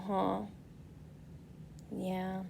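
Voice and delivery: usual speaking voice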